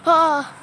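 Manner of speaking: short loud startled "ah"; real jolt of fright; startled cry. Real, not acted. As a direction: gasp out loud, caught off guard. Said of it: "this window just scared me".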